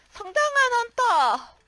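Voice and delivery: high, loud proud proclamation; mock-heroic boast. Player bragging about becoming a grown-up hunter, hammed-up boast. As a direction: crow it like a hero.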